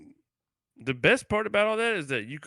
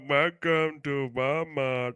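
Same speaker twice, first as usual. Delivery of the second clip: mock Indian accent; 3 semitones higher, much slower than usual. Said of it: "it just sounds Indian".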